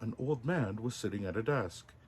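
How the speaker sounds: usual speaking voice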